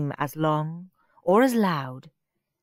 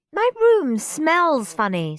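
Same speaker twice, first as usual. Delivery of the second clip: whiny Margaret; 9 semitones higher, 4 dB louder, slower than usual. Acted. Margaret keeps complaining.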